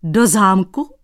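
loud, incredulous yelp. Acted. Cobbler yelping at the painter's plan, incredulous. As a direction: squeal it in disbelief. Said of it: "Vyjekl Dratvička".

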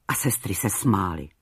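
usual speaking voice

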